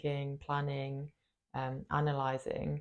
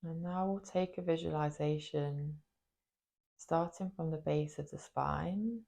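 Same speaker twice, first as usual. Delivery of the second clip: guided meditation. Acted. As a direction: guide them gently into the body, leaving space after each phrase. Leads a body meditation.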